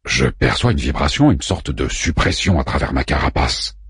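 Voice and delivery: deep-pitched character voice; low, measured report of a sensation; low-voiced Orphu character. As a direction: voice the companion well below the narration.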